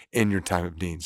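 normal talking voice

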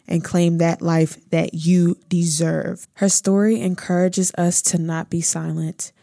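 smooth, measured ad read; promo voiceover. Acted. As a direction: read it like a heartfelt promo announcement. Promo voiceover introducing the guest.

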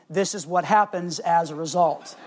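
usual speaking voice

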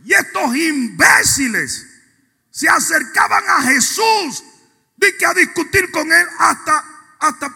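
ferviente predicación. Acted. Preaching at full force. (proclamación a todo pulmón, mucho más aguda)

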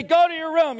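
high, loud command; angry parental order. Acted. Parent orders child upstairs, high angry shout. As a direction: send the child off in acted exasperation.

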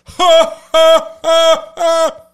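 four clipped, very high, loud "ha" beats, then stop dead; four-beat laugh. Retold or imitated. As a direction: imitate a guy who laughs in exactly four syllables. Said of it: "he'd just go".

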